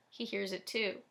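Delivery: usual speaking voice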